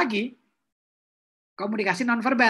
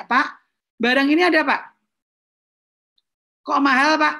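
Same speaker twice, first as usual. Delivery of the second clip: questioning customer; 5 semitones higher, 4 dB louder than usual. Acted.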